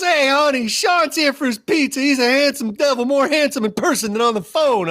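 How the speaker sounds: high-pitched mock yell to the wife. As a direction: call out like a boisterous pizza man. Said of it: "And he just yells".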